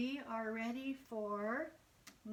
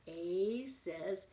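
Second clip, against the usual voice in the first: ordinary talk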